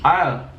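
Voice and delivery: "Aisle" said with a falling end; saying aisle. Shown as a demonstration. Asked by his partner to say aisle, demonstrating it. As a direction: say "Aisle" the way he would say it. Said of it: "Say ow".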